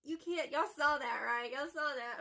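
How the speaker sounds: can't believe what just came out; stunned exclamation. Real, not acted. Reacting, stunned, to her own outburst.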